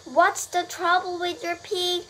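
high, urgent questions; anxious loudspeaker call; worried announcer. A narrator's reading. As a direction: press for answers, alarmed. Announcer alarmed over fainted pig.

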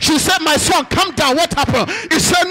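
loud, high, fervent preaching; preaching at full force. Acted. Pastor rousing the church, preaching at full force. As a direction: rouse the congregation with every line.

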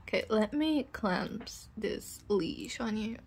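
usual speaking voice

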